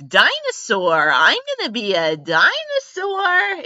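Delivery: put-on high, slow child's declaration; proud little kid announcing his dream. Imitating his childhood self, high and slow. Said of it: "you can get away with".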